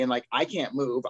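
ordinary delivery

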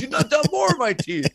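very high, squeaky mock protest; mock outraged movie villain. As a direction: wail it like a beaten cartoonish thug.

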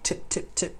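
light unvoiced ticks for tapping spoons; tiny tongue-tip taps; imitated spoon tapping. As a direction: mimic little spoons cracking eggs. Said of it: "little spoons".

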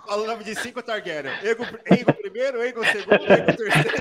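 amused, cracking up mid-list; real laughing while talking. Real, not acted. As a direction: list names through laughter. Cracks up listing identical names.

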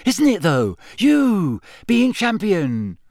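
put-on high-pitched boyish awe; starstruck. A narrator's reading. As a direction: gush at your hero, stretching the last words. Starstruck young boy gushes.

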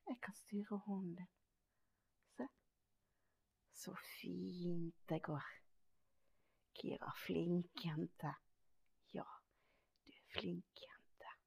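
slow gentle whisper to keep the dog calm; soft whisper. Real, not acted. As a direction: breathe the words, slow and hushed.

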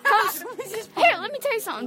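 imitating the coach. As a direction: ham up a preachy coach. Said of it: "Coach Sparks said".

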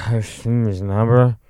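loud, slow imitation of drunken slurring; slurred drunk. Drunk friend leaning on counter hits on cashier, loud slur. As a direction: garble it all except the last word. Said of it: "only word I could understand was".

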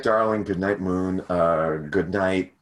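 ordinary talk, nothing marked

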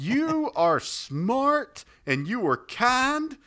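very high and slow, laughing while gushing; high, syrupy, laughing compliments; sweet flatterer. Acted. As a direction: drag out each word of sugary praise. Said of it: "the other guy's like".

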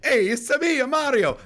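high, loud cartoon Italian accent; Mario. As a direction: shout it in a squeaky Italian game-hero voice. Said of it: "if I do an accent of, of Mario".

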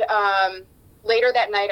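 normal talking voice

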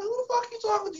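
rookie's imagined outraged reply to the veteran; indignant inner voice. Retold or imitated. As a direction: blurt it high with indignation. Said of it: "In my mind".

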